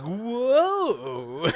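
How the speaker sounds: high, loud, cartoonish whoa; mock startled whoa. Comical near fall down the stairs, cartoonish loud whoa. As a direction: play up the wobble with a big whoa.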